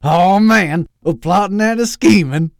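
loud, high Southern excitement; perked right up. Acted. Doctor Loeb hearing about plans, excited. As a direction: burst out in gleeful Southern enthusiasm. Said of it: "perked right up".

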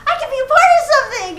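excited. Retold or imitated. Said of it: "I just got slowly more excited".

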